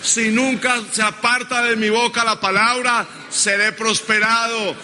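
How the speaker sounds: high, full-force pulpit declaration; insistent, drawn-out preacher's phrases; preaching call-and-response. Real, not acted. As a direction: lead the congregation in a bold vow. Preacher leading congregation in declarations.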